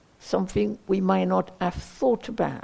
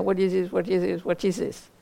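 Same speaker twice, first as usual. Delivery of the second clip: monotone, run-together chanting repetition; mantra-like repetition; faster than usual. Shown as a demonstration. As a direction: repeat it mechanically like a mantra. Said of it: "not to repeat it like a mantra".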